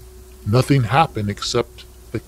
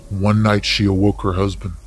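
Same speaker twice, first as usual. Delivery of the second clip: ordinary delivery, nothing marked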